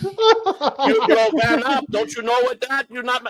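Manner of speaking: put-on high-pitched Polish-accented scolding. Inspector scolding the owner about the van, Polish accent. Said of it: "he sounded Polish to me".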